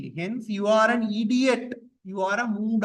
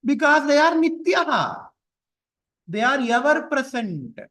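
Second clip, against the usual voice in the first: louder and higher, hitting "are" hard; emphatic answer; 8 semitones higher, 6 dB louder than usual. Shown as a demonstration. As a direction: drive home "Because they are nityaha" with force. Teacher answers own question emphatically.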